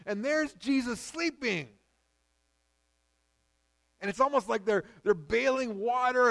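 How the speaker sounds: high and loud, building preaching. Acted. Preacher describing the storm to his congregation, rising intensity. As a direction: paint the storm with urgency.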